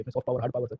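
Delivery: usual speaking voice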